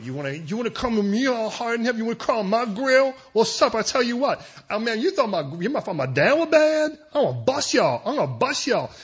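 high, loud, hammed-up tough talk; mock gangster threat. King threatening the people as a gangster, blustering mock menace. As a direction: threaten them like a cartoon gangster.

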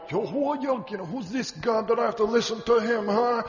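high, put-on challenging voice; defiant Pharaoh. Pharaoh scoffs at God before his people, defiant challenge.

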